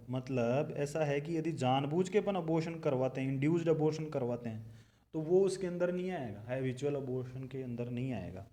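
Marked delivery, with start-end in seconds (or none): none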